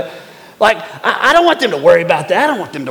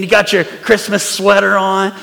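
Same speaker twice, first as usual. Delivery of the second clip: ordinary delivery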